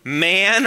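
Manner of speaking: Man hit hard and loud; Man said sharply, not tender like beloved; sharp stress on Man. Shown as a demonstration. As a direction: punch the word Man like a rebuke. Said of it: "he goes after it".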